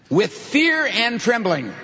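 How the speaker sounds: slow, high, weighty recitation; deliberate, spaced-out quotation; literal rendering. Shown as a demonstration. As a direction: draw out FEAR and TREMBLING word by word. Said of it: "give you literally the Greek sentence".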